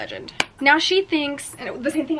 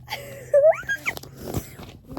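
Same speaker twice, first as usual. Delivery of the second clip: high crying squeal of a laugh. Real, not acted. Reader sees the couple kiss, squealing laugh. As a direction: squeal with delight. They're kissing.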